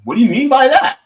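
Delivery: loud, high-pitched indignant question; acted affront, rising sharply in pitch and volume; offended challenge. Imagined people challenging his pronunciation, loud mock offence. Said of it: "people will be like".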